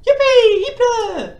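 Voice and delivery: very high mock-cheerful squeal; sarcastic yippee. Player mocking the game's rain, sarcastic squeal. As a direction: fake delight, dripping with sarcasm.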